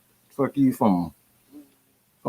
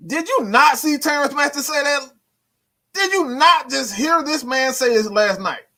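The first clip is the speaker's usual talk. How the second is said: much higher, incredulous rant; can't believe what he's hearing, voice flying up; exasperated disbelief; 12 semitones higher than usual. Real, not acted. Vlogger ranting at a commenter in high exasperated disbelief.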